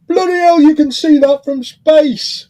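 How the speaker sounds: loud, high-pitched yell across the street; retold shout. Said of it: "shouted across".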